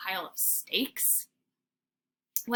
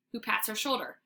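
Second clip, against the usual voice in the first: ordinary delivery, nothing marked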